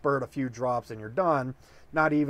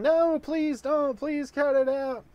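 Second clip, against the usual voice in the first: begging; 11 semitones higher, slower than usual. Acted. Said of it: "She's begging".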